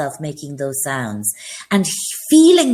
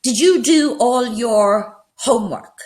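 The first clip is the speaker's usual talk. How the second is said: higher, slower questioning teacher; stern teacher question; 7 semitones higher than usual. Shown as a demonstration. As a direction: ask it like a teacher checking up. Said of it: "So I said".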